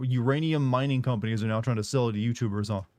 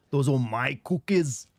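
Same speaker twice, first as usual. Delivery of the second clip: slow Austrian Arnold, landing it; successful Austrian accent; slower than usual. Retold or imitated. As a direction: stretch cookies like Arnold. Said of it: "There we go".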